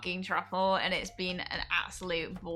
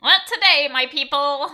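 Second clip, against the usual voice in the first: playful loud announcement, higher pitch; loud teasing call; 5 semitones higher, 10 dB louder than usual. Real, not acted. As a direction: call it out high and loud to chat.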